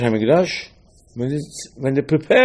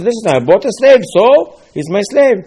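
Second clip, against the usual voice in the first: insistent owner; 8 semitones higher, 7 dB louder than usual. Acted. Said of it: "He says".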